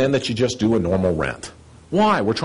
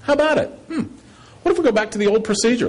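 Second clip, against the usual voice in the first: raised much higher, an asking association member; pleading association voice; 11 semitones higher, faster than usual. Retold or imitated. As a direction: pitch it way up as an association member politely asks for options. Associations asking about the options.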